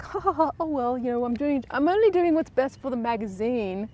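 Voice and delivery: mock-innocent high smug laugh into excuse; smug villain. Catherine brushing off Sophie, high smug mock innocence. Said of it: "with her smug, evil little face".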